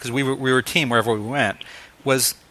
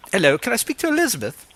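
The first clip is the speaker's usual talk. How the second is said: imitated British accent, pitched high; [name] in British accent; 6 semitones higher than usual. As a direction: put on a British phone-call voice. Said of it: "I do a great British accent".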